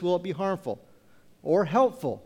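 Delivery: ordinary talk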